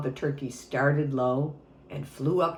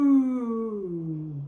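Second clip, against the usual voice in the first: an ooh that slides down from high to low; voice falling high to low; 6 semitones higher than usual. Shown as a demonstration. Teacher leads kids tracing the cranberry rolling down, falling ooh. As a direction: trace the cranberry rolling downhill. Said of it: "Start with your voice up high".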